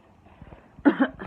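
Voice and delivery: loud hacking cough; a real, racking cough; harsh cough. Real, not acted. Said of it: "So this is what I mean".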